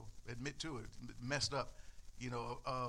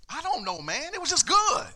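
high, loud, rising on good; as if the question is absurd; incredulous gush; 11 semitones higher, 10 dB louder than usual. Retold or imitated. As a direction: friend dismisses the question, delighted. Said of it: "he looked at me incredulously".

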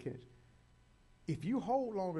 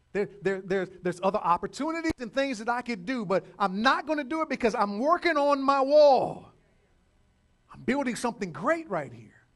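preaching that builds to a loud, high declaration; full-force preaching; 6 semitones higher, 11 dB louder than usual. Acted. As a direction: declare your resolve to the congregation, loudly.

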